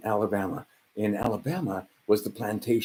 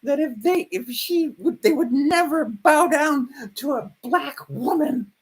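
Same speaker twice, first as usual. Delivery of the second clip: sneering impression of the other side, pitched way up; mocking liberal voice; 3 dB louder than usual. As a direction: parody a shrill pundit, stretched out and high. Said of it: "they were hearing".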